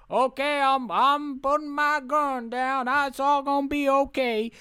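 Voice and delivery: high, slow, drawn-out drawl; put-on placating voice. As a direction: talk down a gunman, high and slow. Acts out Hanks surrendering gun.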